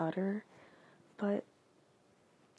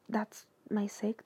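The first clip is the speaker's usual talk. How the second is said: ordinary talk, nothing marked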